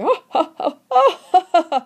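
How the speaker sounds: high, loud crazed cackle; mad laughter. Acted. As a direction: laugh like a man out of his mind. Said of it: "He is laughing".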